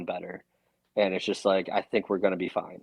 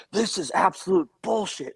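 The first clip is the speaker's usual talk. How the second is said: imitating a furious doctor, much higher and louder; 11 semitones higher, 4 dB louder than usual. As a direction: play the doctor blowing up over the paperwork. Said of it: "He was just furious".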